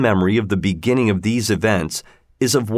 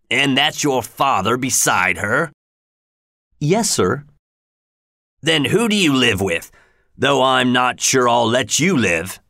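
rough, threatening questions; a brute toying with a scared child; menacing convict; 2 semitones higher than usual. Acted. Convict questioning Pip and threatening him.

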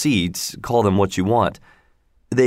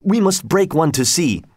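higher-pitched, excited insistence; exclaiming; 6 semitones higher than usual. A narrator's reading. Little Frenchman urging Jarvis to break one, exclaiming. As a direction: urge it eagerly, pitch lifted. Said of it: "exclaimed the little Frenchman".

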